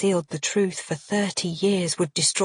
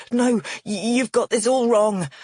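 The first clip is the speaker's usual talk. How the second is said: raised, stammering denial; flustered protest; 5 semitones higher, 4 dB louder than usual. A narrator's reading. Man denies the accusation, flustered and raised. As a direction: protest that it is a mistake.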